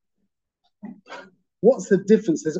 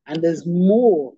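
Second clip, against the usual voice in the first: slow, with more pressed hard; more drawn out and weighted; stress on more; 5 dB louder, much slower than usual. Shown as a demonstration. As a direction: lean on the word more. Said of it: "emphasis on the word more".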